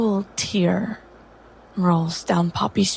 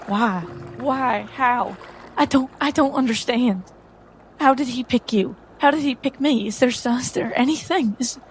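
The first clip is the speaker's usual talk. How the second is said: higher, rising, pleading questions; desperate, bewildered, asking why; distressed questioning; 4 semitones higher than usual. Acted. Poppy confronts her predecessor, distressed.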